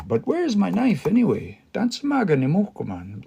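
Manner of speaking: mock puzzled question; confused. Shown as a demonstration. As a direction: sound like you can't find it. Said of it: "Like confused now".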